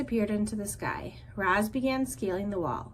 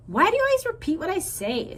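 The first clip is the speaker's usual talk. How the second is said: higher and louder, exasperated bear scolding; annoyed sister bear; 10 semitones higher, 5 dB louder than usual. Acted. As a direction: snap at a pestering little brother.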